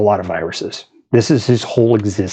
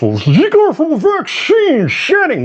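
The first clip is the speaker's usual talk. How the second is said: much higher, slow sneering skeptic voice; mocking skeptic; slower than usual. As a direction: parody the vaccine-shedding crowd.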